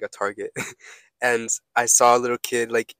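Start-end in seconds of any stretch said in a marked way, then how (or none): none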